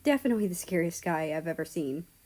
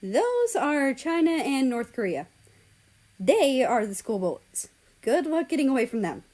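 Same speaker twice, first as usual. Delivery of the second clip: character voice; 6 semitones higher, 5 dB louder than usual. Acted. Character warns about school bullies.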